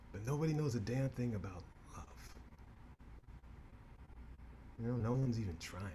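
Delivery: reflective. Acted. Reflective soliloquy.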